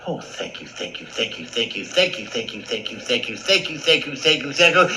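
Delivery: breathless grateful mouse crescendo; gushing gratitude. Acted. Mouse gushing thanks, building.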